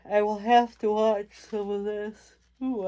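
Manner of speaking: talking through a real yawn. Real, not acted. Tired vlogger apologizing to viewers mid-list, yawning. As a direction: let a yawn stretch the words, then apologize. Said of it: "I'm yawning again".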